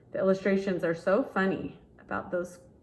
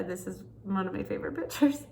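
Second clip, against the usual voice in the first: plain reading voice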